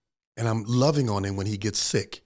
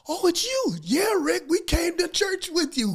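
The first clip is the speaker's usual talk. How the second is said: much higher, shouted joyful hello; excited greeting. Retold or imitated. Neighbor spots Rick at church and shouts happily, excited. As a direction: call out, thrilled to see him.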